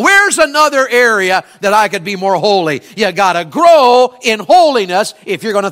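high, loud pulpit preaching; fiery preaching. Acted. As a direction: preach it out with full fire. Preacher at full force.

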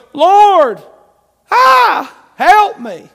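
loud, high anguished plea; pleading cry. Retold or imitated. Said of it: "We go".

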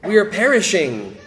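loud, high plea for rescue; desperate cry. Acted. Disciples crying to their Lord in the storm, desperate loud plea. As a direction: cry out to be saved from drowning. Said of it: "you can hear the desperation in their voice".

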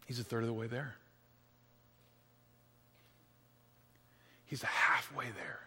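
dropping to a hushed whisper. A narrator's reading. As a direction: hold the room's breath, barely audible. Suspense hush in the story.

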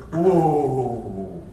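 slow him down gently; calming speed bump. Retold or imitated. Jesus slowing the eager scribe, soft calm restraint. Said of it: "what we need to do in our churches".

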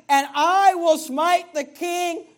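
loud, high, slow vow with "I" drawn out; angry. Retold or imitated. Preacher voicing the counselor's angry counsel, angry. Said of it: "Notice how angry it is".

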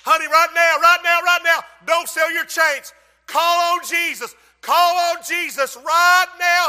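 loud, high desperate plea; a mother pleading through the flames; urgent shouting. Retold or imitated. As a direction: yell at her to call on Jesus. Mother shouting at burning daughter.

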